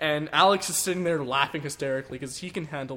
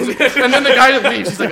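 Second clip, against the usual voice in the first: usual speaking voice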